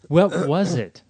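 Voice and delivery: high, very loud question; loud demand. Real, not acted. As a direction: blurt the question out at full volume. Loud demand after being skipped over.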